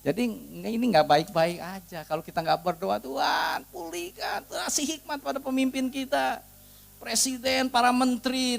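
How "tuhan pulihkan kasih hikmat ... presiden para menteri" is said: lifted, higher pleading prayer; preacher leading the church in prayer for leaders, high and earnest. Acted. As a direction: cry out to God for the leaders.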